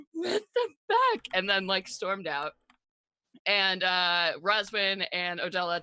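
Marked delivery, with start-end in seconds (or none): 0.1-1.2 high, stunned what the fuck; mock outraged shock. Leo reacting to remembering, high stunned outburst. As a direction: let it burst out in disbelief.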